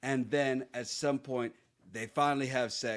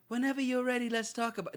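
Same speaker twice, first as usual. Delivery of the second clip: mock hands-off offer; 10 semitones higher than usual. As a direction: mimic a timid, tiptoeing offer to talk. Mimics the hands-off approach she rejects.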